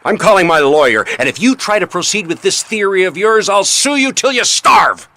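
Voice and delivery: cornered husband threatens to sue the detective, furious; angry threat. Acted.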